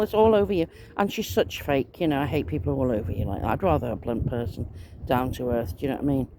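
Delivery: ordinary talk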